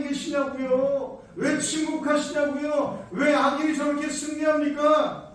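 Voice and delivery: indignant prophet arguing with heaven; prophet protesting. Acted. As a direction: challenge God, pressing harder each line. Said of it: "이렇게 얘기하는 거야".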